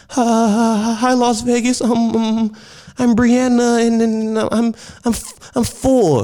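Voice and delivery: put-on high, halting small child voice; little kid caller. Imitating a shy four-year-old greeting the radio audience.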